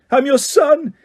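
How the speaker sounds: very high, loud anguished cry; screamed. A narrator's reading. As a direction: scream up at your father. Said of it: "he screamed up at Crouch".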